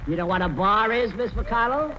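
ordinary talk, nothing marked

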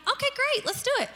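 put-on high-pitched mom humoring her child; playing along. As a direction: go along in a sing-song high voice.

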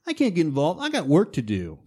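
lightly whined excuse; a hint of a sulky boy; mildly whiny young man; whiny Luke. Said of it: "then he's whiny".

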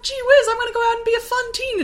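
put-on bubbly fifties teen; wholesome 1950s kid. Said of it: "she's like".

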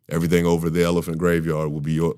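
lightly voiced Mufasa, fast and smooth; mock the movie's weak Mufasa line, lightly; quoting Mufasa. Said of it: "And when he said".

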